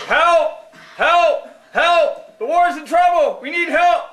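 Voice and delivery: desperate puppet shouting help; frantic calls for help. Acted. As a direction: yell for help like the war is lost.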